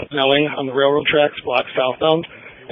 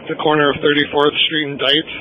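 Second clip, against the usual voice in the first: police radio. Real, not acted. Whole scanner recording is radio chatter.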